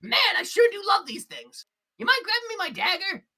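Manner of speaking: high, loud delighted voice; gleeful shopkeeper. Acted. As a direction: relish the weapons out loud. Gleb delights in weapons.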